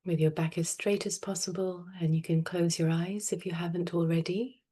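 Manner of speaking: soft, unhurried meditation voice with long pauses; guided meditation. Real, not acted. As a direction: gently guide them into stillness, phrase by phrase. Leads a breathing meditation.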